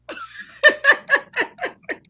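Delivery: long, very high laugh; real uncontrolled cackle. Real, not acted. Laughing at the joke.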